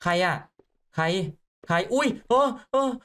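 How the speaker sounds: jumpy, alarmed questioning; startled. Real, not acted. Player stopped at night by a voice, startled. Someone orders him to stop.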